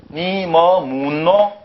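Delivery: drawn-out, even-toned Seoul reading of "니 뭐 묻노"; exaggerated slow Seoul intonation; Seoul accent. Shown as a demonstration. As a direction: read it very slowly as a Seoul speaker would. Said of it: "이걸 서울 사람 말로".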